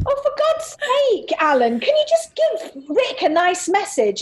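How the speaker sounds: high, exasperated mother scolding her husband; mock a fed-up mum scolding Alan. Daughter imitating her mum scolding dad, high exasperated. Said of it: "and my mum went".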